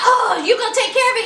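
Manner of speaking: a touch of scornful imitation; incredulous mock retort. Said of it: "She was like".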